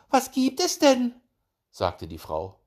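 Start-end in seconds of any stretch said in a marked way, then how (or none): 0.1-1.1 very high, loud wife voice; squeaky-high female character voice; hedgehog wife. A narrator's reading. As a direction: ask in a high falsetto, put out.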